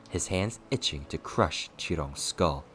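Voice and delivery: plain reading voice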